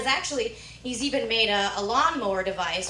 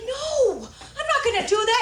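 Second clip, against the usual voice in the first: high, loud protest; acted frantic objection; protesting refusal; 13 semitones higher, 5 dB louder than usual. Granddaughter refusing.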